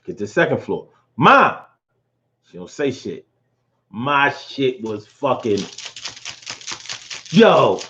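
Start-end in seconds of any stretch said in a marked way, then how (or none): 1.2-1.6 loud call up the stairs; mom, are you here. Retold or imitated. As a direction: call for mom through the house. Calling out on second floor.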